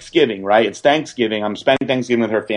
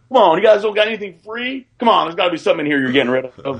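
higher, fast wheedling plea; wheedling beggar; 7 semitones higher, faster than usual. Retold or imitated. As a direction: pester them for freebies. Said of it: "like that".

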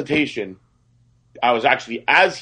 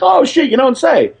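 much higher, louder surprised cop; mock amazement; 13 semitones higher, 5 dB louder than usual. Cop amazed to meet a famous assistant, high surprised exclaim. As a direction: sound impressed and amazed.

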